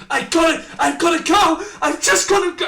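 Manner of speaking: loud, high, desperate yelling; panicked shout. Acted. Dragonborn shouting in panic to the party. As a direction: shout it like he's about to burst out of his skin.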